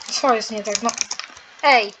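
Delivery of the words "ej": sharp loud shout. Real, not acted. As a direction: yell it out. Calling out at the trailer.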